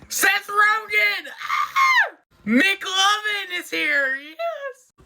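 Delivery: high, thrilled fan shrieks; excited squeal. Real, not acted. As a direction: shriek with delight at the cameos. Fan reacting to cameo actors.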